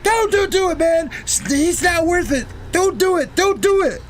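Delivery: high, shouted pleading friend; frantic pleading. Retold or imitated. Little Mikey shouting at Smurf to stop. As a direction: beg him to stop, voice cracking high.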